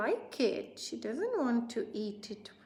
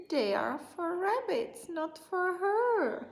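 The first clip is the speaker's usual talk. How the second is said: put-on high whiny girl; 8 semitones higher, 4 dB louder than usual. Whiny Lola reasoning.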